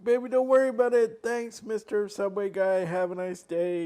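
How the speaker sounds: acted quick brush-off. Husband retelling how he hurried wife out of Subway, mock reassuring. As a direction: re-enact hustling someone out politely, starting loud and high. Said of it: "I was like".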